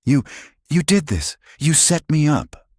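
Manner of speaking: high-pitched, panicked blame; wild accusation. A narrator's reading. Marcus cornered before the cameras, wild accusation. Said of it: "Marcus looked around wildly".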